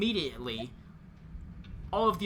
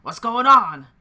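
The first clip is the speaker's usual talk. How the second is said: much louder, raised-pitch shout; calling from inside; 5 semitones higher, 16 dB louder than usual. Acted. Game master voices Lucian calling from inside the house, loud shout. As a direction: shout it out as a startled question from indoors. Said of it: "Lucian call from inside saying".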